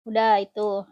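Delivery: usual speaking voice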